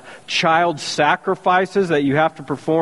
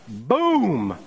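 boom; 3 dB quieter than usual. Retold or imitated. Fire falling from heaven.

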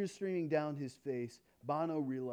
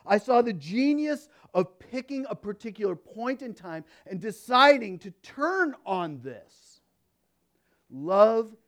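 preaching climax; 7 semitones higher, 13 dB louder than usual. Acted.

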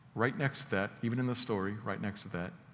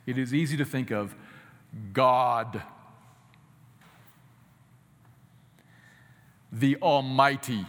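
put-on grand aura; 5 dB louder than usual. Shown as a demonstration. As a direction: boom out the name as if in awe. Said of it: "I'll say it like this".

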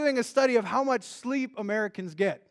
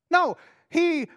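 ordinary talk, nothing marked

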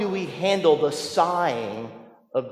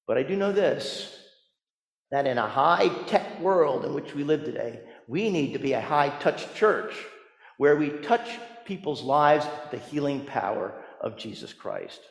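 ordinary talk, nothing marked

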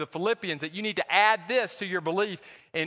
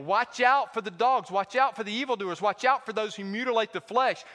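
urgent warning; 7 semitones higher, 5 dB louder than usual. Retold or imitated. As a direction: sound the alarm to the church. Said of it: "Paul is telling this group".

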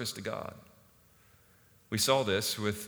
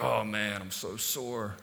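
mock bragging; 2 semitones lower, slower than usual. Young guy to his friends about workout soreness, mock bragging. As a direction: groan about it, secretly boasting. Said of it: "brag about that".

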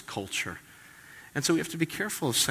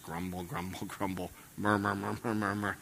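grumbling mumble; 9 dB quieter than usual. Retold or imitated. Pastor imitating the sound of Israel in the wilderness, soft mutter. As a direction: mumble it like a discontented crowd. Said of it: "That was the sound of Israel".

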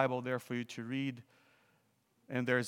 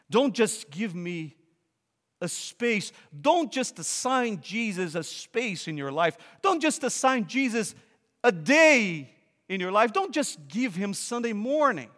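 higher and louder, pleading exhortation; fiery preaching; 8 semitones higher, 8 dB louder than usual. Real, not acted. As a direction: drive it home from the pulpit. Preaching at full force.

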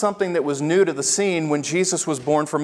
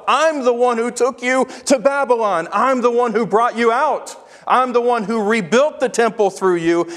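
higher, louder, preaching at full force as Jesus; voicing Jesus; 7 semitones higher, 4 dB louder than usual. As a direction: proclaim it as God speaking, building and slowing at the end.

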